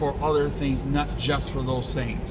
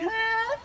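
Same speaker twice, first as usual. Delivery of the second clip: imitated meow. Meowing at a cat.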